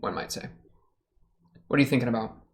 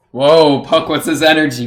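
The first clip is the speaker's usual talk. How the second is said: loud startled whoa, then louder, higher words; surprised whoa; 3 semitones higher, 11 dB louder than usual. Real, not acted. Watching Puck on screen, a loud surprised outburst. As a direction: blurt it out, caught off guard.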